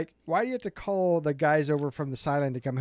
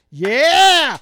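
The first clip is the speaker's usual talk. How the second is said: huge joyful yell, much higher and louder; cheering yell; 10 semitones higher, 14 dB louder than usual. Retold or imitated. Fan cheering from the stands as the rival goes down, ecstatic yell. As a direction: belt out a stadium cheer. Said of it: "And you know what I did?".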